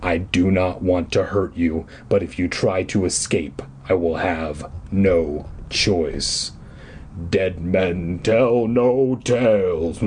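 deep, slow menace; low and slow, dead serious; villain threat. A narrator's reading. Caped man warns the children, deep and slow. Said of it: "caped man threatens".

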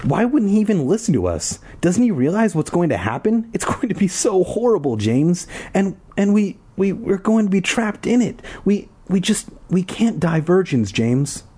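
put-on high, whiny indignant girl voice; high angry voice. A narrator's reading. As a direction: complain in a high, worked-up voice. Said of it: "in a high, angry voice".

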